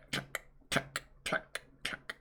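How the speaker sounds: soft unvoiced tuck tuck; whispered sound effect. Reading manga sound effects, soft whispered tucks.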